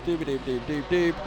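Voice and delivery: idle doobie-doop filler; doodling filler noises. Real, not acted. Filler noises while commentating.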